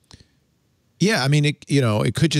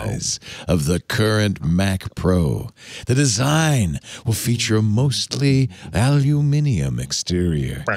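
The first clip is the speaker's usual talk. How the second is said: sexy voice. Acted. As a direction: purr it like a late-night radio host. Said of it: "as I do a dramatic reading of Rumor".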